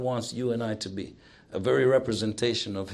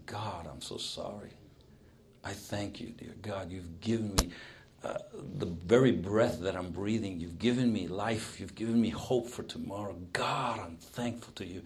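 hushed, lower prayer of thanks; humble prayer; 3 semitones lower, 6 dB quieter than usual. Acted. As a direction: pray it softly, as if privately to God. Models a humble prayer to God.

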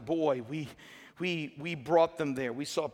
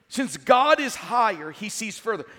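forceful restatement; 5 semitones higher, 6 dB louder than usual. Real, not acted. Restates sermon point with force.

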